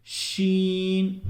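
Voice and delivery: शीन drawn out slowly with a strong sh hiss; sharp sheen. Shown as a demonstration. Teacher to viewers, a long hissing शीन. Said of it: "और तेज़ निकलती है".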